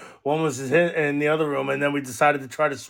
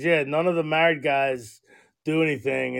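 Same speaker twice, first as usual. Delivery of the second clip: normal talking voice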